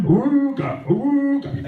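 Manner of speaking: a mock siren, awooga in a high voice; honking awooga alarm; loud siren call. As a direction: wail it out like a siren. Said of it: "set out the siren".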